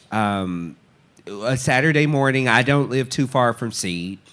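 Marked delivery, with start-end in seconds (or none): none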